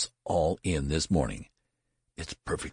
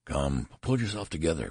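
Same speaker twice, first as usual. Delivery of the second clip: usual speaking voice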